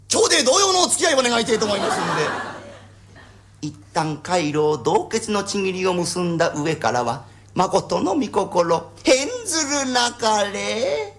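put-on slow, solemn, stilted old-style oath; mock formal vow. Bride declares a pompous formal vow, slow and solemn. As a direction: intone it like a grand ceremonial pledge.

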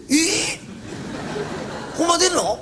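high, laughing disbelief; delighted surprise. Acted. Fishmonger laughing in surprised delight at the offer. Fishmonger hears he'll be paid.

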